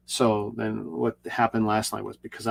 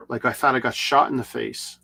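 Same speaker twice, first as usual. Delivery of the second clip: usual speaking voice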